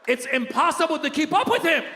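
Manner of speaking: high, strained-up complaint; comic exasperation. Acted. Comedian overwhelmed by daily news, high comic exasperation. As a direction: wail it in mock despair.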